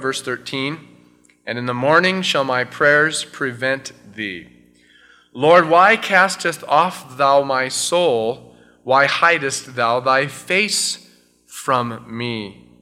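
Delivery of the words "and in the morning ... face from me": loud, fervent reading of the psalm; solemn scripture reading. Acted. Pastor reading the psalm aloud to his church, fervent. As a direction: proclaim each verse to the congregation.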